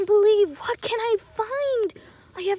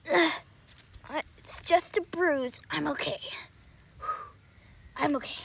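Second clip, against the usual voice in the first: ordinary talk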